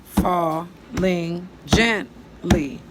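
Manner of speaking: very slow, each syllable drawn out for counting; slow poem reading. Shown as a demonstration. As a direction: read the line slowly so pupils count along. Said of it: "That's five".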